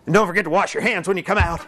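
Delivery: fast, chuckling reminder; tossed-off hospital instruction; quick staff warning. Retold or imitated. Preacher mimicking staff warning, fast and amused.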